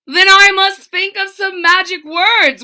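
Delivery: put-on high, loud groaning old king. A narrator's reading. King groaning to himself about magic words. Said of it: "groaned the king".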